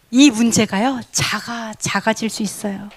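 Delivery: ordinary delivery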